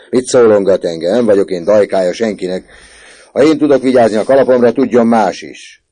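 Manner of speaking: mock his answer and rant on; ranting prefect. A narrator's reading. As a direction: prefect mocking the boy's answer, loud rant. Prefect mocks and rants.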